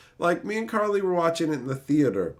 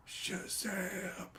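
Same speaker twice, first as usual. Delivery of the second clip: breathy, barely-there whisper of the magic word; mock whisper; 17 dB quieter than usual. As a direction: whisper it like you're drowning. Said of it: "so he can just whisper".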